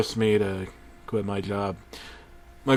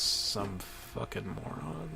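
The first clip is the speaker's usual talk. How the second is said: slow whispered scorn; muttered insult; 2 semitones lower, 15 dB quieter, slower than usual. Acted. Narrator whispering scorn at the tape's maker. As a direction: breathe it out with contempt.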